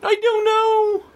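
very high, drawn-out squeal; high-pitched wail. Acted. Stumped quizzer answering his co-host, high drawn-out wail. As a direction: stretch it slowly, way up high.

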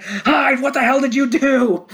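high, loud alarmed shout; shocked yell. Retold or imitated. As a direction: yell it at Hyde in horror. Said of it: "and he's like".

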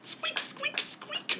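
very high, soft squeaks; hamster squeak. Acted.

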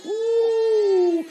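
ooh held long and very high; overcome. Real, not acted. Pastor feeling the Spirit, long high ooh. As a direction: swept up in the moment.

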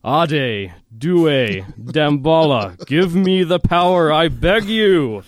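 loud, high, drawn-out chant; hammed-up villain's spell, slow and booming; full-out incantation. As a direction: invoke dark powers slowly, building to a begging cry. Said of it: "she just full out goes into it".

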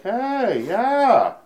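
high, light Harlan voice; Harlan impression. Harlan looking at headshots, high pleased Harlan voice. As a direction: pitch way up as the big-shot buddy. Harlan spotting him on the wall.

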